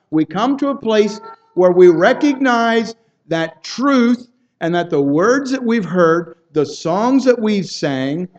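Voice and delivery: fervent preacher building intensity; impassioned preaching. Acted. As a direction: drive each question home to the congregation.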